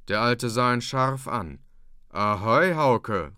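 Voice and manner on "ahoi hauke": a louder call to his son; father calls out to Hauke, a touch louder. A narrator's reading. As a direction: call Hauke's name across the room. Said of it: "rief er".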